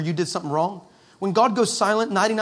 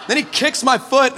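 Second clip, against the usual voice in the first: normal talking voice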